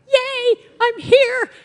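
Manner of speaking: high, loud, drawn-out squeal of joy; excited cheer. Retold or imitated. Excited kid in the front row at her favorite show, gleeful cheer.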